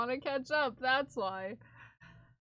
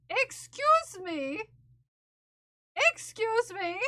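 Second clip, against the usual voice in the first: mock indignant excuse me; 6 semitones higher, slower than usual. Mock offended at chat purchases.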